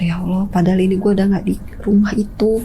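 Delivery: hushed unvoiced whisper; frightened whisper. Telling the host how scared she was, whispering. Terrified at night, recalling.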